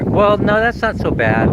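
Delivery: ordinary talk, nothing marked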